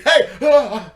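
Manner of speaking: very loud, high "hey" and gasp; mock startling shout. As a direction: act out the shout and the fright. Re-enacts the shout, boyfriend's fright.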